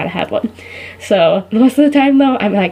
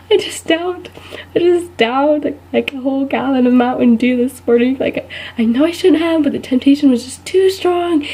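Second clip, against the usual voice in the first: high, hammed-up penitent whine; guilty confession; 5 semitones higher than usual. Guilty person confessing sins to the student, mock-anguished high voice. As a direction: confess it like a terrible sin. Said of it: "they start confessing all their sins".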